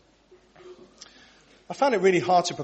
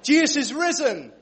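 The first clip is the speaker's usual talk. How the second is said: high, loud call to the congregation; exultant call-out; rousing proclamation; 10 semitones higher, 7 dB louder than usual. Real, not acted. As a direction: proclaim it to set off a cheer. Cueing congregation to cheer.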